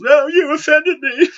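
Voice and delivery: very high mocking whine; whiny offended girl. Mocking young viewers, squeaky offended falsetto. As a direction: mimic a whiny offended kid. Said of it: "act like a bunch of little girls".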